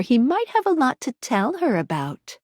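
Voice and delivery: ordinary delivery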